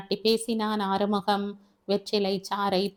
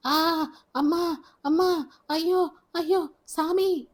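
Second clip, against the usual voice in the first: high, slow wailing cries of pain; anguished cry; 8 semitones higher, slower than usual. Acted. Man crying out in the night after a snake bite, high anguished wail. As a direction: wail out in agony. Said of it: "காற்றை கிழித்து கதறியது ஆறுமுகத்தின் குரல்".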